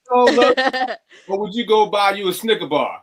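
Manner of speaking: high, laughing teasing question. Real, not acted. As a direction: tease them through a laugh. Joking comparison, laughing.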